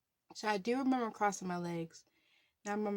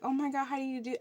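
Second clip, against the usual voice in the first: nervous panic; 6 semitones higher, much faster than usual. Retold or imitated. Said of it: "my nerves got to me".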